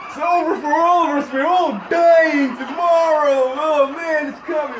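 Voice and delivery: loud, high street preacher warning; doomsayer. Retold or imitated. Corner doomsayer with a sign warning everyone, loud proclamation. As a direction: proclaim doom to passersby. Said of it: "guy on the corner with the sign".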